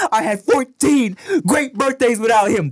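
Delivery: a hint of teary Will Smith; lightly voiced tearful line; quoting the show. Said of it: "on the fucking".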